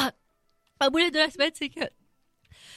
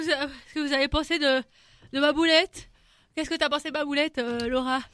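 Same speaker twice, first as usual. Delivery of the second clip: usual speaking voice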